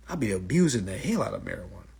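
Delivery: ordinary talk, nothing marked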